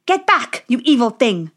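forceful battle cry; commanding shout. Acted. Dwarves drive off the witch.

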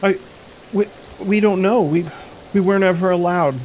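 put-on a girl explaining her parents vanished; frightened little girl.

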